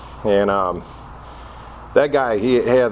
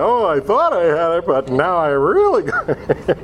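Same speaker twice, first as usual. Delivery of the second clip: mock revelation; 5 semitones higher than usual. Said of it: "it's like".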